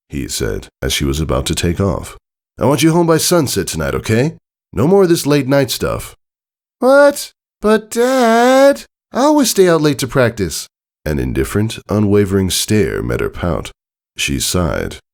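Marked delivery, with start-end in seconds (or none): 2.6-6.1 raised, quicker firm father voice; lightly voiced dad. A narrator's reading. Tycho telling his daughter to be home by sunset, firm. 6.8-10.7 high, loud indignant what; a kid outraged at a new rule; protesting. A narrator's reading. Scootaloo protesting the curfew to her dad, indignant.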